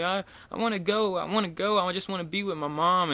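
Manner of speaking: high mock pleading of a child; freaking-out little kid. Little boy telling workers he wants out, mock distress. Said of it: "starts freaking the fuck out".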